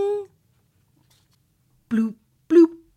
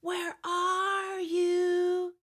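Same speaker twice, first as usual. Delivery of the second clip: long, drawn-out searching whisper; whisper-call slowly for someone hiding; high, soft, stretched whisper; 8 semitones higher, 4 dB quieter, much slower than usual. A narrator's reading. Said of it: "they said, whispering together".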